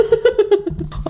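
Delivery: squealing laugh; breathless giggle at herself; high giggle. Real, not acted. Laughs after nearly burning her mouth.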